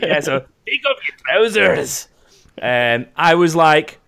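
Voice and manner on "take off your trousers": high, hammed-up Scottish demand; creepy stranger in comically bad Scottish; put-on Scottish accent. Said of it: "a Scottish dude".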